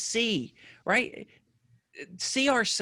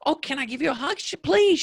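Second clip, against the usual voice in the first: high-pitched, eager quote; excited retelling; 8 semitones higher than usual. Retold or imitated. Podcaster asking a listener for a hug, excited retelling. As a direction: retell it with a delighted lift in pitch. Retold delighted meeting with a listener.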